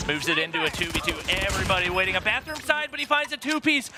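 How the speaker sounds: rising excited esports call; excited play-by-play. Real, not acted. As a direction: build up the hype on the kill. Caster calls a clutch kill.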